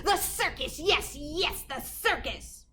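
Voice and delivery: thrilled she has found the answer; excited witch. A narrator's reading.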